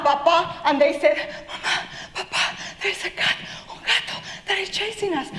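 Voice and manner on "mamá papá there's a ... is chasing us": voiceless, panicked gasping whisper; breathless panic. Acted. Little mice tell parents a cat is chasing, breathless panic. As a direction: gasp it out as if winded from running.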